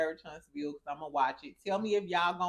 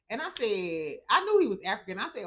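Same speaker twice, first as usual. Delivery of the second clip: normal talking voice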